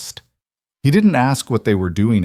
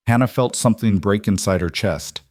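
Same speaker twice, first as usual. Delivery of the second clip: usual speaking voice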